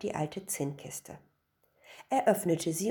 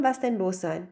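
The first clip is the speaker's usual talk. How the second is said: usual speaking voice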